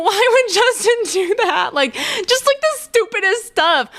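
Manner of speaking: high, whiny imitation of her sad self; squeaky self-parody of a heartbroken fan; whiny mock self. Mocking her past crying self.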